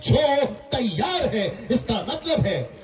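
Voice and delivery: ringing sermon delivery with held key words; full-force preaching. Real, not acted. Preacher calling on the people of town, nation and world, preaching. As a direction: preach it out in emphatic phrases.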